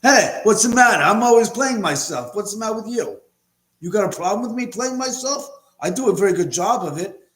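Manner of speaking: quick, high-pitched defensive actor impression; Mark Wahlberg. Actor defending himself to a critic, indignant impression. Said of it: "getting a visual of Mark Wahlberg".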